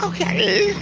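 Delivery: high, light mock attendant voice; imitating attendant. Attendant asking him at the car window, high mock voice. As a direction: pitch it way up like the small attendant.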